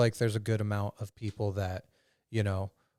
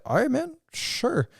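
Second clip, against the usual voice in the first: mock hesitant agreement; slower than usual. Hesitant reply in story.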